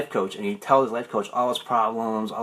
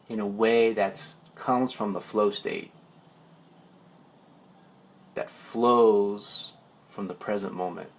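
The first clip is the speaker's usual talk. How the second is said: meditative teaching. Real, not acted. The coach guiding his live viewers toward presence, meditative.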